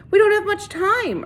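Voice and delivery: high, loud urgent plea; urging. A narrator's reading. Catherine urging the sheriff to act, high and loud. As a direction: push him to hurry. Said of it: "urged Catherine".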